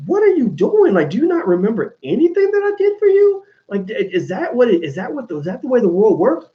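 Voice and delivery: high-pitched, incredulous protest; exasperated disbelief. Retold or imitated. To people he bent over backwards for, incredulous. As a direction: plead in bewilderment, voice riding high. Acts out his own baffled protest.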